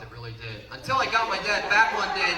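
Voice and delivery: usual speaking voice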